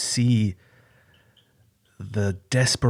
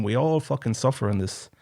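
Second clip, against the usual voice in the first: usual speaking voice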